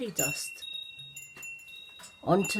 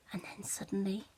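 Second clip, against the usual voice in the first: hushed whisper of suspense; 8 dB quieter, faster than usual. A narrator's reading. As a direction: whisper it to draw them in. Narrator builds suspense.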